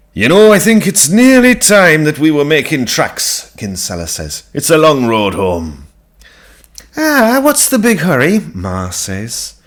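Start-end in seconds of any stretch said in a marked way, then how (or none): 0.1-5.9 louder, hurried farmer's excuse; Kinsella voice. Acted. Kinsella eager to leave. 7.0-8.4 much higher, louder mock surprise; mother protesting the guests' hurry, pitched high. Ma voiced, high.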